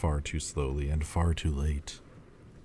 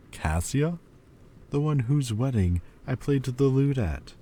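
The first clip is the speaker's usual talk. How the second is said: higher and louder, swept up in fond memories; joyful remembering; 5 semitones higher, 4 dB louder than usual. Acted. Demon recalling the attendants who smiled at him, voice lifting with joy. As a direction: let the joy break through as each name comes back.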